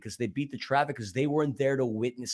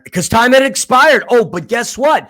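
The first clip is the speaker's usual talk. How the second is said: higher and louder, worked up; excited; 9 semitones higher, 9 dB louder than usual. Real, not acted. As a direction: build the drama, voice rising. Reliving a tense finish.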